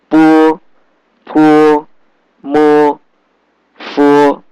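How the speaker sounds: bo, po, mo, fo each ending in a "wo" sound; consonant drill. Shown as a demonstration. Teacher demonstrating consonants to students, held syllables. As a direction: sustain bo, po, mo, fo high and level. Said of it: "and plus wo sound".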